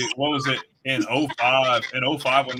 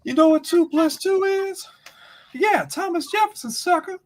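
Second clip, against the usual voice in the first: very high, hammed-up wrestler taunt; high, exaggerated trash-talk impression; The Rock; 4 dB louder than usual. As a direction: imitate a wrestler mocking his opponent, pitched way up. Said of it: "The Rock's like".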